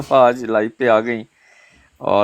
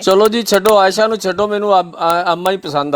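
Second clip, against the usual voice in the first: playful excited; 7 semitones higher, 3 dB louder than usual. Acted. Joking he now prefers grandma.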